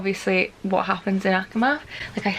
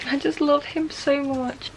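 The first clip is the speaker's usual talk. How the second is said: emotional; 5 semitones higher than usual. Real, not acted. Reader gushing over a character she loves, near tears. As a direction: let the feeling catch in the voice. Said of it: "it's making me emotional".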